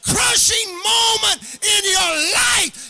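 loud, high, singing preacher's cry to the congregation; full-force preaching. Acted. Preacher whooping to his congregation about God's plan, loud and sung. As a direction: preach it out with a rising, musical whoop.

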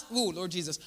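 ordinary talk

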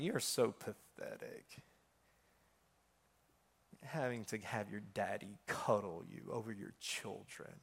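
enemy's taunting voice. Retold or imitated. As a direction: whisper the contempt like a tempter. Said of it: "I heard the voice of the enemy speak".

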